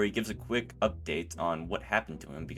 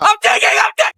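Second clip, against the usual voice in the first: much higher, louder effortful yell; strained shout; 17 dB louder than usual. Acted. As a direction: shout while straining hard. Paddling under coach's orders.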